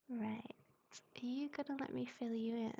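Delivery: ordinary talk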